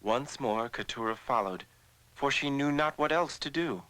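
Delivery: ordinary delivery, nothing marked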